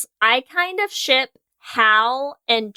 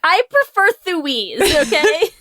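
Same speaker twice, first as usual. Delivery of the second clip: playful insistence; 6 semitones higher, slower than usual. Acted. Playful ship name argument.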